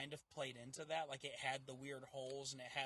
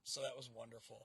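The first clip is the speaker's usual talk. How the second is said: ordinary talk, nothing marked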